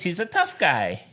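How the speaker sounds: normal talking voice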